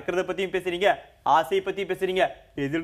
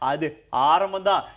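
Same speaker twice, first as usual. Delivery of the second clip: ordinary talk, nothing marked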